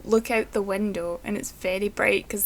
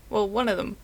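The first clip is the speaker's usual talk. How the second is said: normal talking voice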